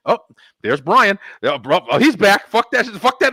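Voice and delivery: loud, high outburst of rage; raging as he relives it; retold fury. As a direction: let the anger explode at the news. His furious reaction to the news.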